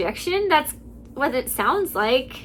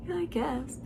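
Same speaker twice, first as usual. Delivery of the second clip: very soft, deflated repeat after a laugh; incredulous mocking echo; 2 semitones higher, 11 dB quieter than usual. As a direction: echo it under your breath in disbelief. Repeats the husband's words after laughing.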